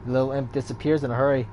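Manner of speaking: ordinary delivery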